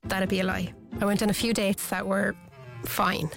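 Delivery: usual speaking voice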